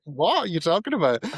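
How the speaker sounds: high-pitched retold disbelief; mock bafflement. Newcomer answering puzzled colleagues, high mock bafflement. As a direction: act out being baffled, voice swooping up.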